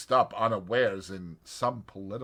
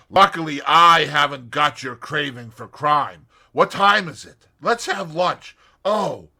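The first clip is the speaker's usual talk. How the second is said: louder, higher, brisk; cheerful and teasing; lively voiced young person; 6 semitones higher, 12 dB louder than usual. A narrator's reading. Tommy/Tuppence lively exchange.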